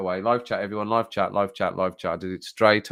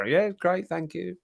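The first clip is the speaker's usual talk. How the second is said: sudden high, soft politeness; fake nice; 8 semitones higher, 4 dB quieter, slower than usual. Grumbling diner answering the waiter, falsely nice. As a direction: switch to a sweet high thanks, hammed up. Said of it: "I'd be being nice about it".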